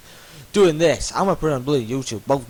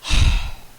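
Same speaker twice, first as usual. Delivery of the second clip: weary sigh. Real, not acted. Fed-up end.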